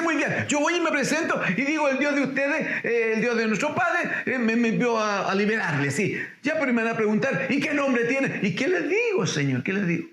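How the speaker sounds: high, fast worried objecting; pleading Moses. Retold or imitated. Moses objecting to the Lord, high and anxious. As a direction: act out a nervous Moses arguing. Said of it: "Y le dice".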